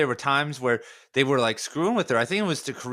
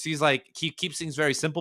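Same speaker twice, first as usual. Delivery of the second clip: ordinary talk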